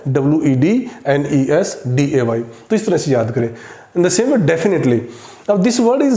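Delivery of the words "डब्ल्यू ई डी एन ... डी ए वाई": slow letter groups: डब्ल्यू ई डी, एन ई एस, डी ए वाई; spelling in three parts. Shown as a demonstration. Teacher showing the three parts to memorise, डब्ल्यू ई डी, एन ई एस, डी ए वाई in slow chunks. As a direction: pause after डी and after एस while spelling slowly. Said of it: "हमने इसको part बनाए".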